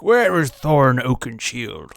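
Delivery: slow, put-on wizard voice; Gandalf. Wizard asking the dwarves after their leader, drawn-out mock grandeur. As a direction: stretch it into a theatrical wizard question. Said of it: "Gandalf is like".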